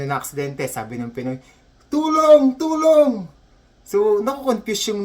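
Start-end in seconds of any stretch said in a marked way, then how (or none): 1.9-3.3 high, loud comic wail; shouting for help. Acted. Injured Pinoy pleading to the Canadian nurse, hammed-up shouting. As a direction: yell it to summon rescue. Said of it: "sigaw siya ng sigaw".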